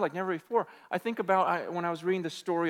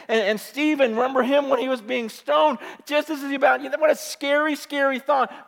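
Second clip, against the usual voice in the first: preaching at full force; 5 semitones higher, 9 dB louder than usual. Acted.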